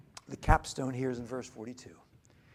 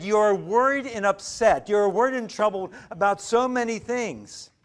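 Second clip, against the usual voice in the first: raised Jesus rebuke; 6 semitones higher, 8 dB louder than usual. Retold or imitated. Preacher voicing Jesus's rebuke.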